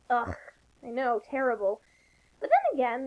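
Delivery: ordinary talk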